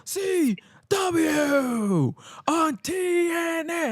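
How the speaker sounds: high, drawn-out ring announcer; hyping up the crowd. Retold or imitated. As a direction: stretch every letter like a wrestling announcer. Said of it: "with Joey hyping up the crowd".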